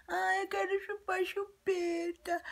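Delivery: put-on high whiny small-child voice; imitating her younger self begging; whiny little kid. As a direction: beg like a toddler. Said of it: "falando assim".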